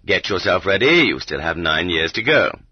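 plain reading voice

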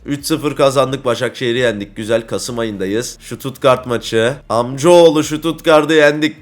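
usual speaking voice

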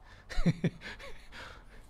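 squeaky high laugh; high breathy laugh. Real, not acted. Pastor laughing at the strange request, high giggle.

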